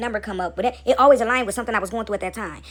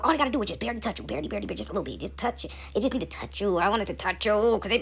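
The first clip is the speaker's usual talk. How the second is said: soft hushed voice; 7 dB quieter than usual. Acted. Voicing God to the listener, hushed and much softer. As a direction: drop to a near-murmur, much softer. God gently promising a light touch.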